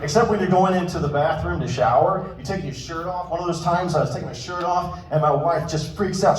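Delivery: ordinary talk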